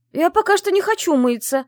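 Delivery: high, loud yell while kicking free; struggling against restraints. Acted. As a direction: shout while wriggling away. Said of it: "брыкая ногами и вырываясь, закричала я".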